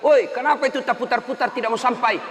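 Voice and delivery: loud, high-pitched shout; mock exasperated holler; imitated heckling yell. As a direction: call out to the imam in mock protest. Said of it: "dia teriak".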